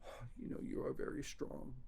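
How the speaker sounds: soft unvoiced Grover aside; Grover whispering. Grover admitting the reader is strong, in a whisper. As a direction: breathe it, grudgingly impressed. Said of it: "It's like".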